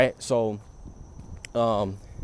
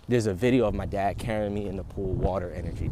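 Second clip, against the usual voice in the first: usual speaking voice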